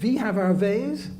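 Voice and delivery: mock Nazi German accent, drawn out slowly; attempted German villain accent. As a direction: put on a slow, hammy German accent. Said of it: "that was my German, uh, Nazi accent".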